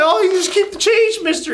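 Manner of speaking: high-pitched, frantic politeness; nervous appeasing. Retold or imitated. Customer handing mob barber the change, nervous appeasing. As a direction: placate him in a very high mock-scared voice.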